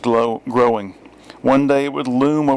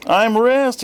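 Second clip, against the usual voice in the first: high bean voice; 12 semitones higher, 4 dB louder than usual. A narrator's reading. Bean character introduces himself.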